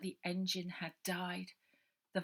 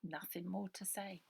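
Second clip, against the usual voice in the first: plain reading voice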